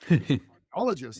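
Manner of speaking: slow, disbelieving repeat of "Cardiologists?"; incredulous echo. Retold or imitated. Coach repeats the client's idea back, slow and disbelieving.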